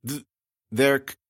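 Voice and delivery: halting voice breaking to a whisper; stammering warning. Acted. The girl warning Amanda, stammering and fading. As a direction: choke out the warning.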